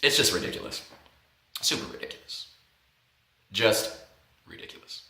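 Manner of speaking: very soft, trailing into a whisper; hushed, fading aside; spent disbelief. Real, not acted. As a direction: say it under your breath, with pauses. Winds down after laughing fit.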